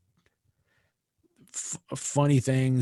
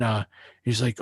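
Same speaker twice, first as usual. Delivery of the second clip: ordinary delivery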